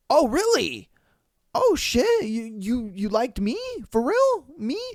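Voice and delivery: high, squeaky mock disbelief; hammed-up astonished lovestruck boy; incredulous delight. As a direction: voice leaps up, stunned and thrilled. Retold boy thrilled she likes him.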